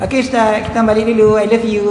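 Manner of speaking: lightly mimicked sing-out farewell; lady student's goodbye. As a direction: imitate a woman calling goodbye to her teacher. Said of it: "Akak tu cakap apa dengan dia".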